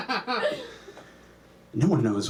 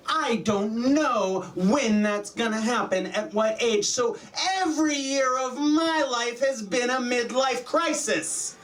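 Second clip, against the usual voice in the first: high, slow, drawn-out panicked rant; anxious rant; 9 semitones higher than usual. Acted. Comic tells audience he could die any time, anxious rant. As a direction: wail each point high, stretching the words.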